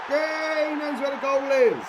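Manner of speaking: high, loud yell of joy at the goal; celebrating a goal at the top of the voice; excited shout. Real, not acted. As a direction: shout it out, thrilled. Penalty scored in football game.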